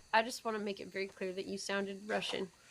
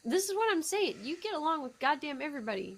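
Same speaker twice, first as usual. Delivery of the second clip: excited exclaim; 7 semitones higher than usual. Real, not acted. Friend exclaims in disbelief.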